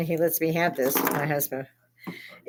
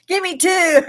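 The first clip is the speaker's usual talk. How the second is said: high, loud eager plea; much higher, louder mock greedy request; eager greedy ask; 11 semitones higher, 8 dB louder than usual. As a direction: beg for a double dose, laughing. Asking for more fentanyl.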